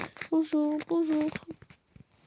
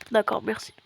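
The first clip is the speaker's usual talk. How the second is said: whispered thanks; 4 semitones lower than usual. Pretend. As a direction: whisper it at the end of the call. Phone call, whispered thanks.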